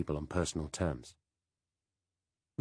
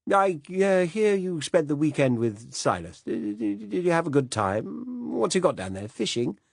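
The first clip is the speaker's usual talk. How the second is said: a fussy, absent-minded British gent apologizing; vague old Director General; 9 semitones higher than usual. Acted.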